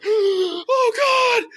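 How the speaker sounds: high-pitched panicked wail; hammed-up yelp of dread. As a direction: cry out in comic alarm. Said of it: "And I panicked".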